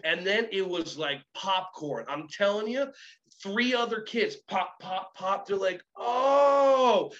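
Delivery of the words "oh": loud, high drawn-out oh; the penny drops all at once; sudden realization. Retold or imitated. Kids grasping the idea, loud high surprised oh.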